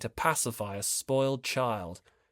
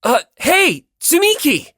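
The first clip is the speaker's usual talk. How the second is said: high, loud shout of alarm; loud startled shout; alarmed call; 11 dB louder than usual. Acted. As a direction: call out as she falls. Hinata calls out alarmed.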